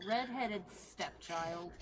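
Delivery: drawn-out, mildly disdainful naming. Shown as a demonstration. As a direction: say it slowly, like a mild slur. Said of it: "the way he says that".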